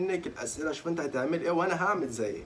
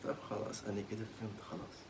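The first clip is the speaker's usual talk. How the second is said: quiet; 5 semitones lower, 11 dB quieter than usual. Real, not acted. Hushed resignation.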